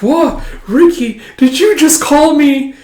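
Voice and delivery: put-on breathless girl, slow on the last words; giddy girlish surprise. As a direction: gasp it out like a smitten schoolgirl. Smitten girl voiced.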